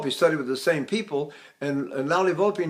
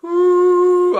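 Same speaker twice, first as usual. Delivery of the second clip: high, much louder ooh held slowly; slow falsetto ooh; 13 semitones higher, 9 dB louder than usual. Shown as a demonstration. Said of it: "I mean slowly".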